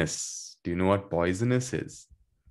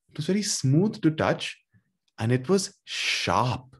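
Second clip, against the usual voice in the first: lively, higher voice, slowing on the key words; animated storybook narration for young learners; story reading to children; 6 semitones higher than usual. A narrator's reading. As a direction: read it like a children's story, leaning on each word.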